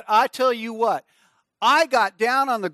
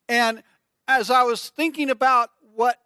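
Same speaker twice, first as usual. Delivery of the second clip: ordinary talk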